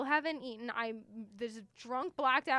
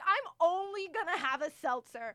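normal talking voice

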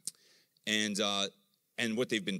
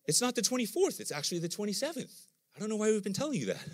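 doctor's voice; 11 semitones higher than usual. Retold or imitated. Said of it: "the doctor goes".

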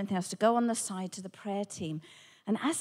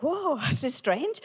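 amused disbelief; 4 semitones higher, 4 dB louder than usual. Retold or imitated. Amazed reaction to lady's story.

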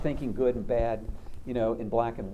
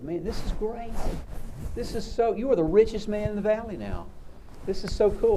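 much higher, thrilled voice; excited neighbors; 8 semitones higher than usual. Retold or imitated. Neighbors congratulate the farmer, much higher and thrilled. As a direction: gush like neighbors seeing a windfall. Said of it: "all his neighbors came out and said".

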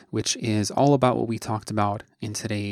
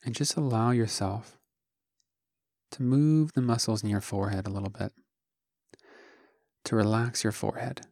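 soft, unhurried meditation voice with long pauses; calm, hushed instruction, phrase by phrase; guided meditation; 4 dB quieter than usual. Acted. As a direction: guide listeners gently through a body scan, leaving space after each phrase. Leads a guided body scan.

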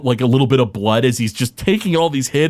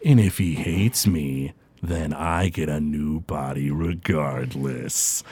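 low, slow, softer villain voice with a smirk; smirking warlock; 10 semitones lower, 7 dB quieter, slower than usual. Acted. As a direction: gloat quietly, drawing it out. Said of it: "And Groon the Warlock smirks".

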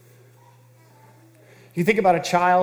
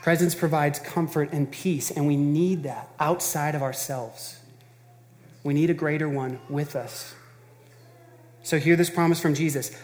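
ordinary talk, nothing marked

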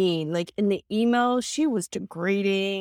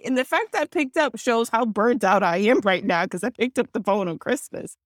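usual speaking voice

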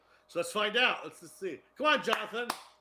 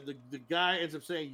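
normal talking voice

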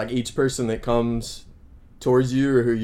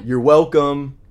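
loud, pointed sarcastic thanks; 4 semitones higher, 8 dB louder, slower than usual. Retold or imitated. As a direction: throw it back at them, biting. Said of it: "I'll give them a real sarcastic".

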